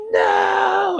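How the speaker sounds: high-pitched, drawn-out retold "no" scream; mock anguished scream. Retelling Luke learning Vader is his father, mock anguished scream. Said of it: "screams and cries".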